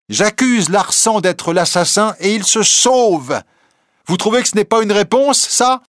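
high, challenging retort; impassioned young reporter. A narrator's reading. Reporter answers the president's reproach, impassioned and challenging. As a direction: fling the accusation back with fervour.

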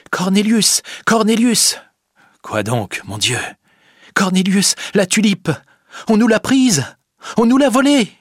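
put-on high, alarmed young woman's cries; panicked cry. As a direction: cry out the terrible news, voice pitched high. Rosa announces the theft in panic. (cri paniqué, voix aiguë)

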